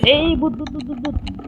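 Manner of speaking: high-pitched startled stammer; game panic. Pretend. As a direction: blurt it repeatedly as the ghost appears. Ghost appears again in game.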